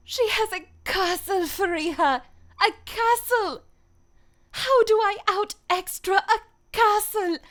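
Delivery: put-on loud, high-pitched chavvy English accent. Voice actor performing a requested line for chat, chavvy accent. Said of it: "chavvy kind of accent".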